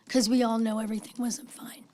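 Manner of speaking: hoarse, voiceless whisper; raspy whisper. Real, not acted. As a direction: let the lost voice drop to a whisper. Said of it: "I have no voice".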